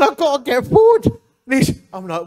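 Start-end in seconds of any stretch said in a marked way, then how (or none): 0.0-1.1 loud, high call to get forward; mock the stuck convert's urgent cry; retold desperate call. Convert on the space hopper, calling out loudly to reach the cross.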